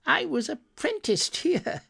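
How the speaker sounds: very high-pitched, delighted exclamation; excited old Scrooge, voice leaping up; excited recognition. A narrator's reading. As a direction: burst out with thrilled recognition. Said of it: "said Scrooge".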